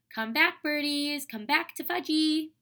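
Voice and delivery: put-on high, loud toddler calling after birds; half-scream it like a small child, pitched well up; read-aloud version of a little kid's scream; mild mock scream. A narrator's reading. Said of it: "was screaming".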